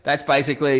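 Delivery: usual speaking voice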